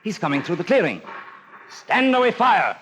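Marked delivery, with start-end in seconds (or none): 1.7-2.7 high, loud command to fire; barked order. Acted. The captain orders his men to fire, loud and high. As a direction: shout it across the stockade.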